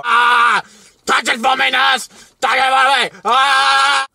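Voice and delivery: high, loud mock yelling; hammed-up old man shouting. Man imitating old man yelling to his nurse, frantic mock shout. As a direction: scream it like a frantic old pervert. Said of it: "Only in a more old man voice".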